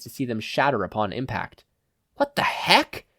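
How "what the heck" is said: high, startled outburst; surprised exclaim. A narrator's reading. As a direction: blurt it in shock. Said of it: "Hojo exclaimed".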